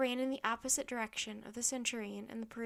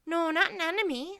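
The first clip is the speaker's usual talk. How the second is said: high, loud objection; protesting; 6 semitones higher, 9 dB louder than usual. A narrator's reading. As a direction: protest urgently to the centurion. Annie protests over the roar.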